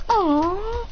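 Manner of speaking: high, drawn-out aw; cooing. Acted. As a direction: coo over a cute baby animal. Everyone admires tiny wolf.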